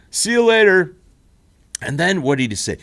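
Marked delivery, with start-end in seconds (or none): 0.1-0.9 loud, higher hammed-up farewell; mock goodbye. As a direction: belt the goodbye like a goofy host. Quoting Terry's goodbye.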